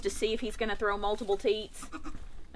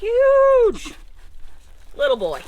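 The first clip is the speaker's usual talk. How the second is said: high, loud delighted burst; excited; 7 dB louder, slower than usual. Real, not acted. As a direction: blurt it out in delight. Newborn kid arrives.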